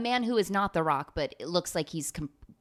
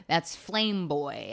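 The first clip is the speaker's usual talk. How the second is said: cracking up at her own joke; laughing; 3 semitones higher, 10 dB louder, much slower than usual. Real, not acted. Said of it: "laughs at own line".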